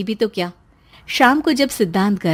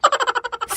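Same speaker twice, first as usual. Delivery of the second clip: high mocking laugh; 13 semitones higher, 3 dB quieter than usual. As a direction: laugh him off. (मज़ाक की हँसी)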